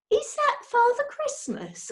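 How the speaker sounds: put-on young bear voice. A narrator's reading. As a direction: ask it wide-eyed like a small bear. Paddington's voice.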